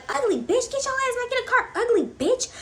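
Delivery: shrill-pitched mocking of the angry girl; a high, mocking take on the girl yelling at her friend. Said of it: "she was calling random ugly, like".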